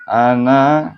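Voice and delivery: Ana sung loud with a rising pitch. Shown as a demonstration. Teacher showing where the melody rises, rising Ana. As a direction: lift the voice upward on Ana. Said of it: "naiknya di sini".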